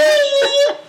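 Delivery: loud, very high squealing laugh; shrieking laugh. Real, not acted. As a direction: burst out in a high cackle.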